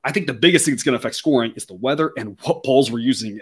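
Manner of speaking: ordinary talk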